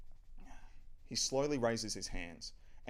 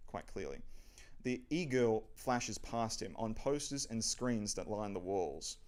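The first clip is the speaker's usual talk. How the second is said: usual speaking voice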